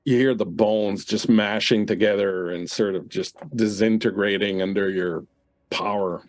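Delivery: ordinary talk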